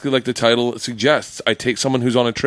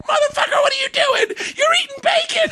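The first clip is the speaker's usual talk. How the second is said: high, shouted Bronx scolding through laughter; Bronx accent. Retold or imitated. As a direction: imitate an outraged Bronx woman while cracking up. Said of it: "you can picture her Bronx accent".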